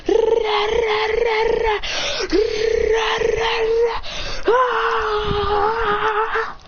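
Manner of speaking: high rolled roars ending in a long scream; mock battle roar. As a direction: imitate a gamer straining to the limit. Straining through the final level.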